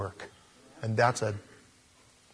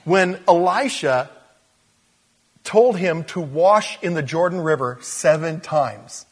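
high, loud, impassioned preaching; preaching at full force; 7 semitones higher, 7 dB louder than usual. Acted. Pastor urging the congregation, preaching at full force. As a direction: lift the congregation with rising fervor.

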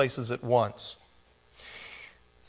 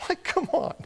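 sharply higher, incredulous come on; pitched-up incredulous jab; exasperated. Real, not acted. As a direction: toss it off in disbelief. Exasperated aside after laugh.

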